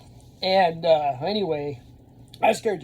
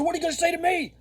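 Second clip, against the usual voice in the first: high confrontational yell; daring the kid to answer back; retold challenge; 14 semitones higher, much faster than usual. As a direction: get right in his face. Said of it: "I start yelling at this kid".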